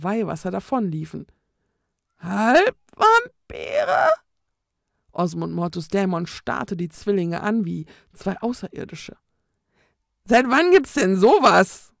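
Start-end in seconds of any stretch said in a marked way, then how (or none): 2.2-4.1 very high, very slow, tearful rising question; disbelieving ghost. A narrator's reading. Ghost hears the twins are half vampires, slow, high and shocked. 10.2-11.9 loud ghost voice, indignant. A narrator's reading. Ghost demands since when half vampires exist, loud and indignant.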